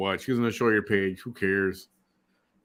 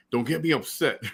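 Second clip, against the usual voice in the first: Joe Pesci impression; 6 semitones higher than usual. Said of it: "then he's like".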